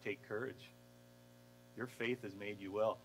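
soft, low comfort; tender words to a healed woman; gentle reassurance. Retold or imitated.

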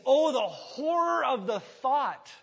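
high, loud, slow dramatic dread; mock horror. Preacher paraphrasing Paul's dread for laughs, high and loud. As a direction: play up the horror like a melodrama. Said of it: "But the idea is".

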